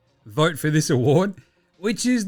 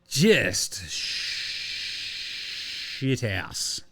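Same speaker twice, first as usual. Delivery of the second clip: slow, softer, breathy disgusted mutter; 5 semitones lower, 7 dB quieter, much slower than usual. Real, not acted. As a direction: mumble it under your breath. Muttered aside.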